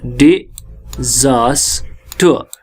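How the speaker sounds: "डिजास्टर" in three separated syllables, final r silent. Shown as a demonstration. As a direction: break "डिजास्टर" slowly into di, zaas, tuh. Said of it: "इसीलिए यहाँ पर". ("डिजास्टर" को तोड़कर, धीरे-धीरे)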